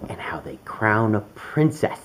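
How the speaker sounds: plain reading voice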